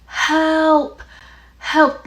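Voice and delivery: high, drawn-out mock cry for help; imitated screaming. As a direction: mimic a distant scream at half strength. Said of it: "like, repeated screaming".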